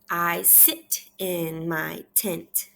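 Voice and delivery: half-speed, word by word; measured repeat-after-me reading; slow read-aloud. Shown as a demonstration. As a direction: model each word separately for a young reader. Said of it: "I want you to repeat after me".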